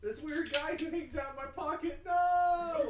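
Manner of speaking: high laughing yell; laughing shout. Acted. Shouting shirt joke while laughing.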